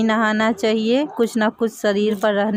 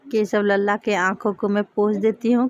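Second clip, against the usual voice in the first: ordinary delivery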